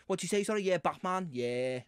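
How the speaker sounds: put-on chatty, rambling Scouse accent; Batman. Batman chatting about carjacking, Scouse accent. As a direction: play a Scouser with filler-laden small talk. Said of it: "are you Scouse Batman from The Dark Knight?".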